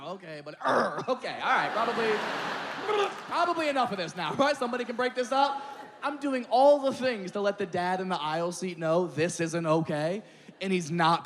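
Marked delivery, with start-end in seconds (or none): 0.0-5.6 higher, fast, nervous polite hints; awkward polite hinting. Retold or imitated. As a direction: drop hints without confronting anyone. Polite hints to get kid away.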